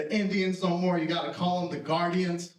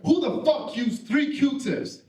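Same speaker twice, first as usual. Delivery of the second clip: slow, higher-pitched mock outrage; imitated angry bark, pitched up; barking uncle; 5 semitones higher, 4 dB louder, slower than usual. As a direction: demand it like an indignant jail uncle. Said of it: "he barks in my room".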